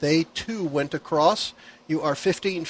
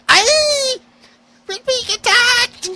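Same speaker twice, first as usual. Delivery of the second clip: high, shouted panicky goblin cry; 6 dB louder, slower than usual. Acted. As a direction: squeal it like a startled goblin. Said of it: "What terrifying goblin voices!".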